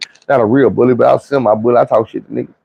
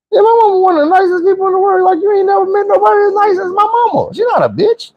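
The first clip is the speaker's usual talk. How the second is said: very high falsetto pleading; squealed protest. Retold or imitated. Narrator defending his mama to the girl, squealed protest.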